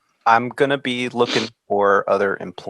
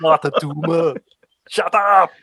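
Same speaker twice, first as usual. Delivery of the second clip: mock Austrian accent; 5 semitones higher, 3 dB louder than usual. Schwarzenegger impression.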